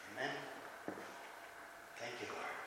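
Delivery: murmured under the breath; near-silent personal prayer; hushed prayer. Real, not acted. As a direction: whisper a private thanks to God. Muttered private prayer mid-sermon.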